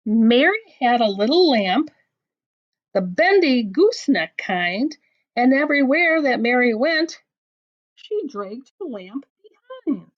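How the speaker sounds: lively storybook reading with voiced bits; picture-book read-aloud; reading to children. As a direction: read the silly poem to a child. Reads a funny rhyme book aloud.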